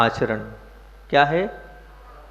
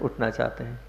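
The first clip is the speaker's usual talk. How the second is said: normal talking voice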